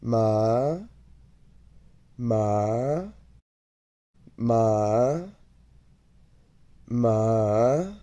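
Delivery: rising Mandarin tone on "ma"; second tone. Shown as a demonstration. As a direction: lift each held "ma" upward like a question. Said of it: "Let's take each one at a time".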